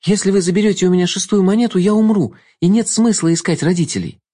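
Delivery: put-on urgent young girl's plea; anxious girl. A narrator's reading. Girl pleads with Jester.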